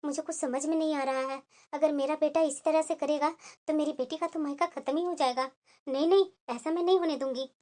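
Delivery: soft and low, thinking aloud to herself; worried inner monologue. Acted. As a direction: murmur your fears to yourself, quietly. Mother alone, worried inner thoughts. (चिंतित धीमी आत्म-वार्ता)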